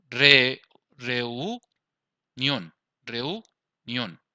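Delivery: reunión drawn out as reu... nión; reunión broken into separated syllables; split into syllables. Shown as a demonstration. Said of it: "tenemos el acento en esta sílaba".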